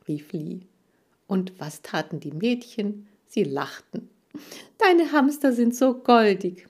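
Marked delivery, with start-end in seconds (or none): 4.8-6.7 higher, louder cooing; girl cooing over hamsters. A narrator's reading. Laura cooing to Kira about her hamsters. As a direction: gush over the cute pets.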